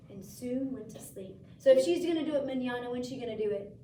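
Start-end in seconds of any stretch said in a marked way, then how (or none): none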